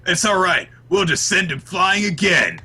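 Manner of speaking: high, loud hero confidence; rally them with hammed-up bravado; gung-ho bravado. Host acts out Asta reassuring the team, mock heroic.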